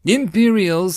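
loud, high warning cry; urgent soldier's yell; yelled alarm. A narrator's reading. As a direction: raise the alarm. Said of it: "someone yelled and pointed".

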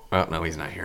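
usual speaking voice

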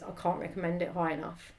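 ordinary talk, nothing marked

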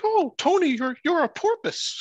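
high, loud granny voice gushing at her son; imitating grandma. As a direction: squeal it like an excited old grandma. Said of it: "my grandma was like".

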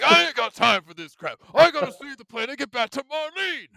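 loud, high gruff tough guy; Barrett voice. Retold or imitated. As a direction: bellow it like a big brawler. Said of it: "Barrett's like".

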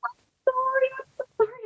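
very high-pitched mock squeal of apology; strained, high retold sorry; retold frantic apology. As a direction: squeal out apologies, imitating yourself in labour. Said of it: "I'm screaming, and I'm also saying sorry".

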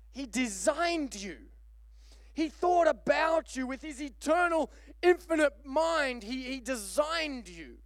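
impassioned, rising preacher cadence; fervent preaching. Real, not acted. Preacher tells congregation God designed them, in fervent preaching. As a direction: preach it with swelling conviction.

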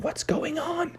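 high, soft squeak of confusion; bewildered. Acted. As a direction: ask it in a small, high startled voice. Froggy startled while rising in air.

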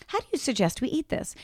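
softly spoken rising question; understated catchphrase. Shown as a demonstration. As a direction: ask it quietly, voice lifting at the end. Demonstrates the family catchphrase.

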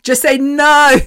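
high, loud, emphatic command; pissed off. Retold or imitated. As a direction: imitate a fed-up friend insisting. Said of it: "so pissed off".

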